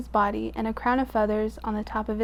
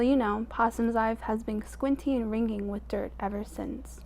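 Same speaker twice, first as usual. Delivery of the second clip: ordinary delivery, nothing marked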